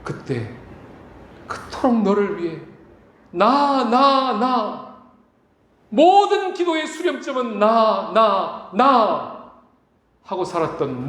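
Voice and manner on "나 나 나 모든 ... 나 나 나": mock self-centered. Mocking self-centred prayer.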